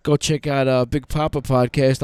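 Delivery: ordinary talk, nothing marked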